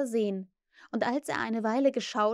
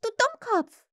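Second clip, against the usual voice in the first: high fairy insult; scolding, very high-pitched cry; Tinkerbell; 12 semitones higher, 4 dB louder than usual. A narrator's reading. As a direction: call out in a high little voice. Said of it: "rief Tinkerbell über ihnen".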